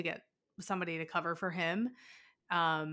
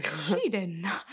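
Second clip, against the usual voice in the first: indignant; 3 dB louder, slower than usual. Retold or imitated. Outraged retort to rude lady.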